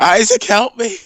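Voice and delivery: high, loud mock scream; screaming for help. Injured man calling Isaac, mock screaming. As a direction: cry out in hammed-up distress. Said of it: "I continue to scream".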